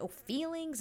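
high-pitched challenging question; mock bickering retort. Acting out Kate bickering back at him, high challenging retort. Acted bickering between the couple.

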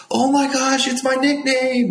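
a hammed-up overjoyed dad; excited dad. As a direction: squeal it like a delighted father. Dad thrilled opening the suit.

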